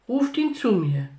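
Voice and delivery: a hint of a commanding call; lightly voiced summons. A narrator's reading. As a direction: call for someone to be brought. Jesus summons over the crowd.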